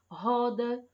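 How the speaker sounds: roda with a strong guttural R. Shown as a demonstration. Teacher reading a strong R example, clearly. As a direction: say roda with the R rasped hard in the throat. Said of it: "o R tá saindo com som forte".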